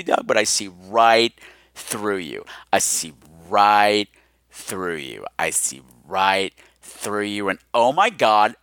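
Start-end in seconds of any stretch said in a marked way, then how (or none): 0.0-2.4 low teasing drawl. Pretend. Host teasing Doug about his fake call, in a low teasing murmur. 2.7-5.2 slow low murmur. Pretend. Host teasing Doug again, slower and fainter in a low murmur. 5.4-7.5 slow low tease. Pretend. Third repeat, slow and low.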